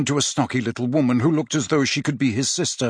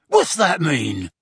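slower, louder angry yell; 4 semitones higher, 4 dB louder, slower than usual. A narrator's reading. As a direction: snap it out violently. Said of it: "yelled the Death Eater".

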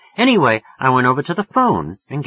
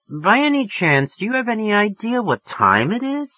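sarcastic woman; 12 semitones higher than usual. Acted. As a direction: pointedly remind him of the hour. Woman scolding late caller.

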